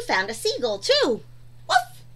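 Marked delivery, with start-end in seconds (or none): 1.7-2.0 puppy bark. Acted. Voicing the puppy Biscuit.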